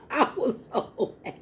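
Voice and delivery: laughing. Real, not acted. Laughing retelling failure.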